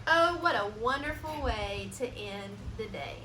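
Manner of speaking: plain reading voice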